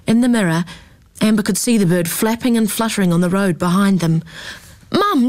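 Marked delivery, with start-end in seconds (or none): 4.9-5.3 high urgent yell; a much higher cry of Mum. A narrator's reading. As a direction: call out to stop the car. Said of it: "she yelled".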